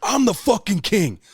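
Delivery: drawn-out, high boastful bellow; wrestling-style hype yell; triumphant scream. Retold or imitated. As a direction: crow it loudly at all your rivals. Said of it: "I fucking scream".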